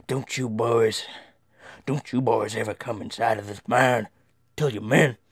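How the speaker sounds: stern father's warning, put-on voice; gruff-talking father. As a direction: warn the boys firmly in a father's voice. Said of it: "My father walked me and David out".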